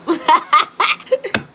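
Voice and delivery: squealing laugh, pitch jumping up; real giggle fit at her own joke; high burst of laughter. Real, not acted. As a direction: laugh out loud, high and bright. Cracking up at the card.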